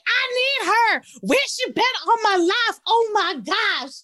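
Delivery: very high, excited gushing; high breathless delight, slowing at oh my gosh; gushing fan. Retold or imitated. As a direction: squeal it like a thrilled fan. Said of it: "They're gonna be like".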